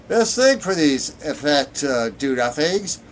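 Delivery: man clumsily attempting a Nord accent; bad Nord accent. A narrator's reading. Nord grumbling about Valenwood between bites, in a bad Nord accent. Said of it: "trying to do a Nord accent".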